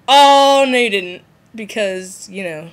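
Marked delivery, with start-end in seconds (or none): 0.1-1.2 loud sassy oh no you didn't. Pretend. Jane watching the burning doll, loud sassy retort. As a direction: toss the line with mock attitude. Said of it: "she'd be like".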